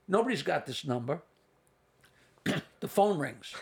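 ordinary talk, nothing marked

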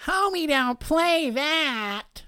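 high, slow, loud sketch character voice; Damon Wayans impression. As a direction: lay the catchphrase out slow and high. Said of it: "That was Damon Wayans".

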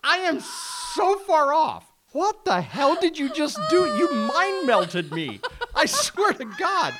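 high, loud laughing rant; laughing disbelief. Real, not acted. Host cracking up at cohost, high laughing disbelief. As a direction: crack up while scolding your friend. Cracking up after being derailed.

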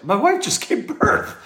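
high, slow, laughing quote of the new father; new dad quote. Retold or imitated. Fan retelling what the new dad said at the game, high and laughing. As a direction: play the stunned new dad through a laugh. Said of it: "He's like".

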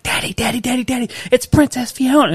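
put-on high, eager little-girl voice calling to dad; a small daughter tugging at her father, thrilled; excited little girl. Dad imitating his daughter spotting the princess, high and eager. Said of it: "So she's like".

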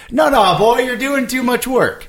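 very high, drawn-out pleading coach; comic high-pitched anti-trainer. Acted. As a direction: fuss over the fighter in a high character voice. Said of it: "he's the anti-trainer".

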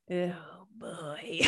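breathy aside under the breath; soft whispered wince. Real, not acted. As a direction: hush it like you can't believe he said that. Reacting to awkward subway confrontation.

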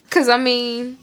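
normal talking voice

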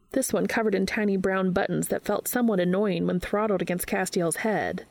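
ordinary delivery

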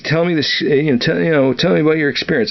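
a hint of a commanding general, pushing for the story; general's request. General to captain at Christmas party, lightly voiced demand. General asking about Panama.

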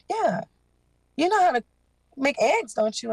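high mimicry of the woman talking her into it; voicing the woman. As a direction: lift the pitch to quote her coaxing. Narrator voices the woman persuading her.

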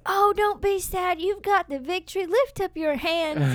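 high, drawn-out pep talk; mock cheery encouragement. Someone interrupts her praying to cheer her up, mock cheery. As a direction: imitate an over-eager encourager.

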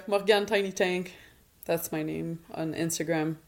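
ordinary talk

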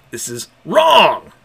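loud, slow, dragged-out "wrong"; anguished cry. Acted. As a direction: let the word wrong break into a wail. Dominic's outburst.

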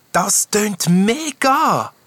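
high, drawn-out delighted exclamation; enthusiastic. A narrator's reading. Knight cheering the ghost's plan, enthusiastic.

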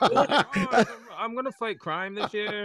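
high, loud, laughing mock voice; mocking costume wearer.

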